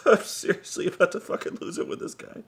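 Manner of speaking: whispered through clenched frustration; tense whisper. Real, not acted. Confiding to viewers he'll snap, tense whisper. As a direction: hiss it like you're about to snap. On the verge of snapping.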